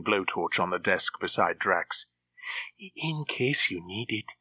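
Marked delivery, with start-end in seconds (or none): 2.8-4.3 slow, softer creepy henchman; hopeful creepy henchman. A narrator's reading. Krebs offering the blowtorch, creepy hope. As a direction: leave it hoping it gets used.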